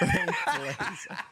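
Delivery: high laughing echo; giggling through the name. Acted. Echoing the joke name.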